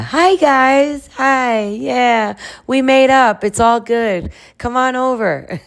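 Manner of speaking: high-pitched, sing-out greeting voice; hammed-up happy hello to friends; mock cheery greeting. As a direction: call out in a put-on high voice, sweetly overdone. Acting out reunited pair greeting friends.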